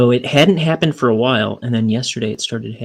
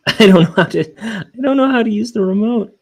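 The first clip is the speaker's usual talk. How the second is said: high-pitched, laughing helplessness; hammed-up helpless protest breaking into a laugh; flustered laughing; 11 semitones higher, 6 dB louder than usual. Uncle admitting defeat to the kids, flustered and laughing.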